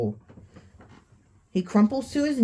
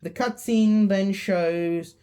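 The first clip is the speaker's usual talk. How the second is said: ordinary delivery, nothing marked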